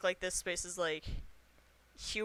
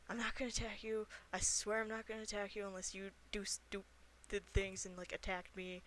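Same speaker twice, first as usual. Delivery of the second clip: half-whispered; 8 dB quieter than usual. Acted. Player promising a hidden character safety, half-whispering. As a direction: keep your voice down, promising no harm. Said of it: "I'll kinda whisper".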